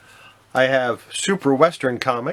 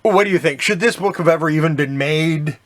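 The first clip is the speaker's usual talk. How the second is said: excited; 5 semitones higher, 5 dB louder than usual. Real, not acted. Asks the audience, amused.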